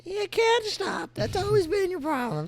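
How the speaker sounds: put-on high, slow old granny voice; grandma voice. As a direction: play a sassy old grandma. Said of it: "close it out in the, in the grandma voice".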